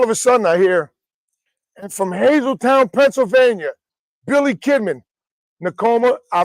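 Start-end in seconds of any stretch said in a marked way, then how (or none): 1.8-5.0 higher-pitched wrestling introduction; ring announcer. Retold or imitated. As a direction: announce the wrestler, name held up high. Ring announcer heard on TV, imitated.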